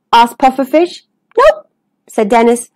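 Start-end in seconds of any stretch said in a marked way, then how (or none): 1.4-1.7 very high, short squeak; teasing denial. Acted. Dennis rejects a guess at I Spy, high teasing. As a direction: pop it out high and cheeky.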